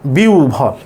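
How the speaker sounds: বিউভল said as "biu-bhol"; careful two-part বিউভল; correct pronunciation. Shown as a demonstration. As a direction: glide বিউ, then breathy ভল. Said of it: "শুদ্ধ উচ্চারণ আছে".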